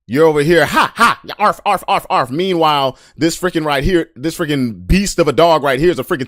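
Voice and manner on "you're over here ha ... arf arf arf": high, yappy mock barking; mock chihuahua yap. Mocking tough talkers as a yapping chihuahua, hammed-up barks. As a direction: yap like a tiny angry dog, higher and louder.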